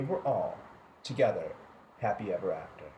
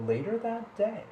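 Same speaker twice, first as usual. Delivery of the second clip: usual speaking voice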